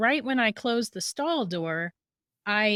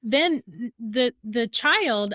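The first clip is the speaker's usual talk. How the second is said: ordinary talk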